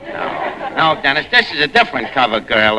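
ordinary talk

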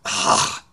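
unvoiced-breath groan of exasperation; audible huff. Passenger annoyed by the TSA agent's question, audible huff. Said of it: "audible, hu-, like".

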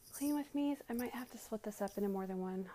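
ordinary delivery

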